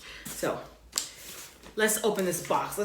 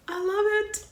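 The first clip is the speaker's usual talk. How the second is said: much higher, a bit louder, giddy; high excited squeal; 4 dB louder than usual. Real, not acted. Vlogger gushing over her goddess shirt, squealing. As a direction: squeal with joy.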